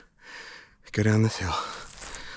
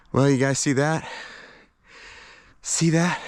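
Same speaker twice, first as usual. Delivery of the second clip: panting between words; winded from the climb; out of breath; 3 semitones higher than usual. Real, not acted. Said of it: "That's why I'm out of breath".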